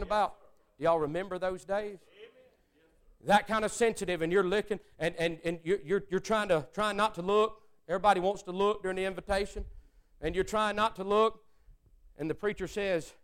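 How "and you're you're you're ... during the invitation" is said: usual speaking voice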